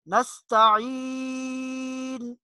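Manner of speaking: نستعین slowly, ی drawn long; نستعین, stretch the yaa; slow chanted نستعین. Shown as a demonstration. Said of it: "کھینچ کر تھوڑا سا لمبا کر کے پڑھیں گے".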